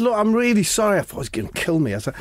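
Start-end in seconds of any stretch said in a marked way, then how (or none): 0.0-1.0 high-pitched mock pleading; acted frightened apology. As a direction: beg for mercy in a raised voice. Retold begging for life.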